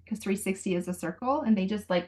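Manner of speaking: ordinary talk, nothing marked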